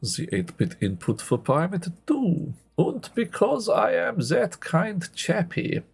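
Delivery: put-on hammy German-like accent, slow and deliberate; badly faked Germanic accent with swooping pitch; terrible put-on accent. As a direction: do a bad German-style accent, measured pace. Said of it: "That's a terrible accent".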